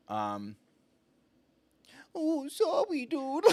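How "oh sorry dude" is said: very high, softest little voice; Hootie voice. Acted. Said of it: "you just give him, like, the softest".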